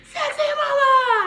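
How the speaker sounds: very high, drawn-out holler; loud, stretched catcall yell; as loud as possible. Retold or imitated. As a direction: shout it across a graduation crowd. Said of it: "as loud as he could".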